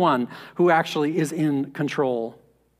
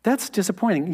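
mild disappointment; 3 semitones higher than usual. Retold or imitated. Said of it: "I read that and I say".

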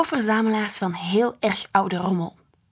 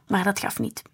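plain reading voice

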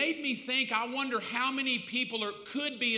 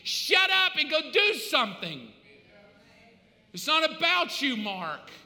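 loud, higher, furious scolding; angry yelling; 5 semitones higher, 4 dB louder than usual. Acted. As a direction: tell him off for whining about his church. Dead relative yelling at preacher.

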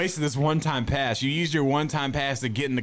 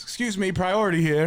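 mock self-important guy, pitched up; priority-line show-off; 4 semitones higher than usual. Friend mocking the storyteller as the priority guy, self-important. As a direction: announce your privilege to the whole line. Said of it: "you're like the guy who has priority".

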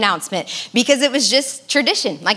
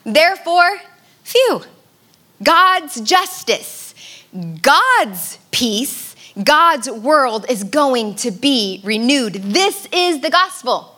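rising excitement; 3 semitones higher, slower than usual. Acted. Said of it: "N T Wright puts it like this".

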